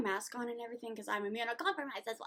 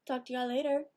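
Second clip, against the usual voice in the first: ordinary delivery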